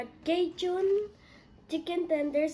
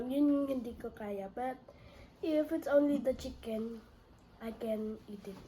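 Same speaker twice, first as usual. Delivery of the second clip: usual speaking voice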